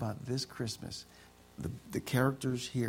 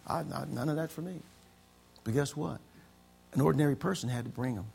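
ordinary talk, nothing marked

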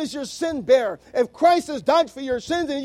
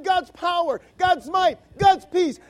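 louder, higher cries on each phrase; preaching shout; 5 semitones higher, 5 dB louder than usual. Real, not acted. Preacher stresses God's might to the congregation, rising shout. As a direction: call it out to the congregation.

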